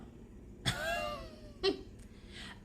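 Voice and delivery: high silent giggle under the breath; breathy giggle. Real, not acted. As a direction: giggle knowingly through the nose. Joke about the sale.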